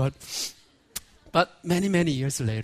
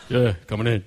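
a lower, deliberately deep welcome; lowered voice of a girlfriend's father; 9 semitones lower than usual. Retold or imitated. Girlfriend's father welcoming the nervous boyfriend in, lowered voice. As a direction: play the stern dad greeting the boyfriend, voice lowered. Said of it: "fathers learn how to lower the voice".

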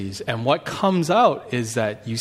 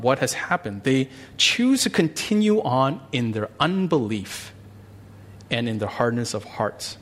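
ordinary talk

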